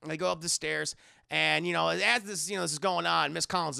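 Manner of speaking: ordinary talk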